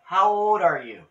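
calling out loud. Real, not acted. Questioning a spirit loudly.

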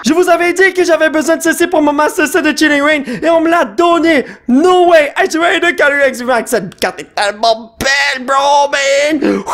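high-pitched, breathless-with-joy raving; overexcited. Real, not acted. Collector given the card he needed, overexcited raving. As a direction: gush in amazement, easing off toward the end. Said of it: "il faut que je me calme".

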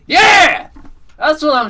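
high, loud cheer; elated yell; triumphant shout. Real, not acted. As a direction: belt it out in celebration. Celebrating loot box.

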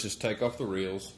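ordinary talk, nothing marked

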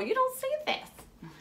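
usual speaking voice

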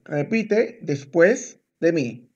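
word by word, with clear gaps; slow it right down for a learner; slow and measured. Shown as a demonstration. Teacher telling student to repeat after them, slow and measured.

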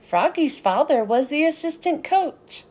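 plain reading voice

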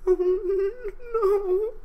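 high, sobbing falsetto; Fluttershy weeping alone after fleeing; crying. Acted. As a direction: cry the words into your hooves. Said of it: "she cried into her hooves".

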